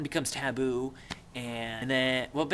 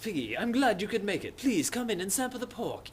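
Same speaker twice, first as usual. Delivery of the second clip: higher, hammed-up genteel welcome; gracious host; 8 semitones higher than usual. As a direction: greet guests with exaggerated courtesy. Jack as gracious feast host.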